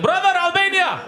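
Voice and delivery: high-pitched excited hail to a brother; imitated enthusiastic Albanian greeting. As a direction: call it out high, like greeting a long-lost brother. Acts out a greeting in Zurich.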